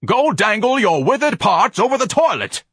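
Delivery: high, loud, furious scream; savage scream. Acted. Ignatius to Miss Trixie to get rid of her, savage scream. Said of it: "Ignatius screamed savagely".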